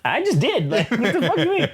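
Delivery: high, laughing exasperated comeback; laughing mock retort. Elektra snapping back at Daredevil, high laughing mock sass. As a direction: laugh through a sassy retort.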